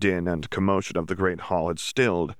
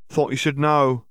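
high, louder character voice; Auror witch announcing; 7 semitones higher, 5 dB louder than usual. A narrator's reading. Wiry Auror witch informing the hall, pointed announcing. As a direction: pitch it up and push it out, as the Auror.

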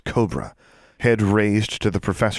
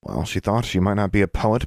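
ordinary delivery

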